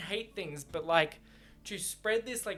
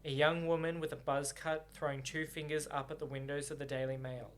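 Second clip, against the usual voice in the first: story narration; 3 semitones lower than usual. A narrator's reading. Reader narrating the novel to viewers, calm.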